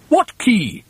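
high, called-back query; shouted question. Acted. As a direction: call back down, puzzled, in a high voice. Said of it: "shouted the roly-poly bird".

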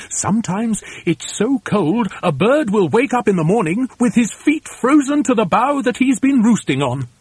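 sing-song feathered know-all; chatty storybook bird. A narrator's reading. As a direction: tell the warning with lively high swoops.

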